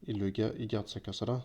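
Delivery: normal talking voice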